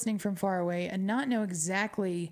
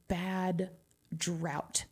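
ordinary delivery

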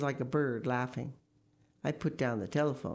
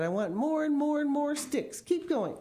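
high, insistent demand; eager, high-pitched chivvying; urging them on; 12 semitones higher, 3 dB louder than usual. Acted. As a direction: build it up, egging the family on. Father urges the workers on.

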